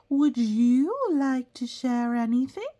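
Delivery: high, loud kind teacher voice; teacher asking. Acted. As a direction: invite the shy kid to share. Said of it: "she asked".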